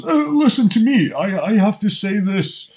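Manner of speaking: mocking a rival with a put-on upper-class London accent. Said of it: "What type of accent does he have?".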